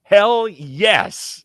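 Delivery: big loud whoop of approval; fired-up cheer. Real, not acted. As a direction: cheer it like your team just won. Cheering after the call.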